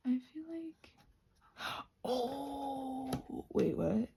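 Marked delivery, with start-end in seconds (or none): none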